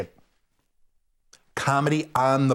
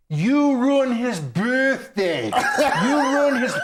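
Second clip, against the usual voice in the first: higher, slow, hammed-up accusing through laughter; mock outraged women; 10 semitones higher, slower than usual. Middle-aged women accuse the comedian, mock outraged. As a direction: scold him like an angry crowd.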